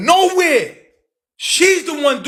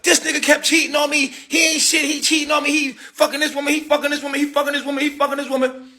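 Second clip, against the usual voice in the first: lightly voiced ex in a high chant; hint of a nagging ex-girlfriend; mocking ex; 7 semitones higher, faster than usual. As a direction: repeat the accusation like a broken record.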